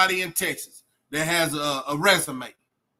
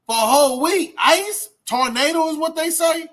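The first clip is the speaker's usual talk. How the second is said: exclaiming in disbelief; 10 semitones higher, 5 dB louder than usual. Real, not acted. To his viewers about the week of snow, loud incredulous exclaiming. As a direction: pitch jumps way up, louder, incredulous.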